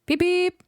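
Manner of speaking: high whistle call; whistle beep. A narrator's reading. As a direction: whistle like an engine. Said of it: "whistled Edward".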